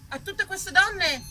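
ordinary delivery

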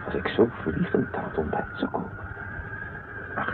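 ordinary talk

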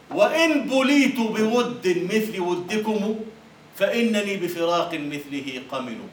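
slow, sorrowful declaiming; poem recital. Acted. Teacher reciting the parting verse, slow and solemn. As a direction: draw the verse out slowly.

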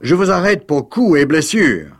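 high, loud commanding voice; imperious. Acted. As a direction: arrest him with authority. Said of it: "cria Gilda impérieusement".

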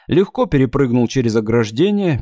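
ordinary delivery, nothing marked

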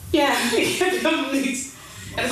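laughing in disbelief. Real, not acted. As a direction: laugh at the mishap. Recorder failed, laughs.

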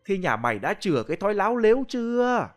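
high, scolding shriek at a disrespectful man; a medium points and lashes out; angry shriek. Acted. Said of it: "chỉ tay rồi rít lên".

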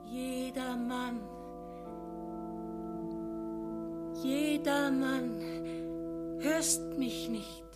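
faint, frail calling out; weak frail voice. Acted. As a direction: call out weakly like a sick old woman. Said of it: "ruft mit schwacher Stimme".

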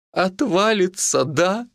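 high, slow, pitiful question; plaintive squeak. A narrator's reading. Mitka fears the worst and asks healer, plaintive. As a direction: squeak it out piteously, drawn out. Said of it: "жалобно пропищал Митька".

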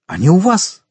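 high, urgent question; eager exclamation. A narrator's reading. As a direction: burst out asking, pitch jumping up. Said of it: "воскликнул Монте-Кристо".

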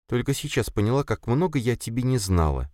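high, gentle female voice; mild, high-voiced wonder; bewildered, faintly hopeful. A narrator's reading. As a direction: say it uncertainly, with faint hope. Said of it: "с лёгкой надеждой и в то же время растерянно".

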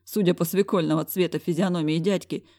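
plain reading voice

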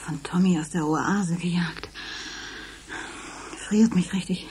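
leise, verängstigt geflüstert; flüstert sich allein im Dunkeln Mut zu; ängstliches Flüstern. Acted. Gloria allein nach Albtraum. (verängstigt vor sich hin geflüstert)

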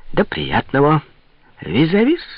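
high, mincing farewell lifting at the end; affected dandy. A narrator's reading. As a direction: trill it out like a provincial fop. Dandy clerk takes his leave. (высокое жеманное прощание щёголя)

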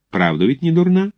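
high, rising question on «не дурна»; coy, fishing question. Acted. Mother asking cadet about her daughter, coy high question. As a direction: lift «не дурна» high, inviting a compliment.

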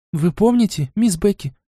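high, amazed question; surprised exclamation. A narrator's reading. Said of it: "удивлённо воскликнула Лайза". (удивлённо воскликнула)

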